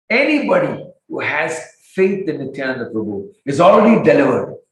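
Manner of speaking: big happy announcement in separate phrases; loud declaration. Acted. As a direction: proclaim it to everyone, pitch lifted. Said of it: "stood there and loudly said".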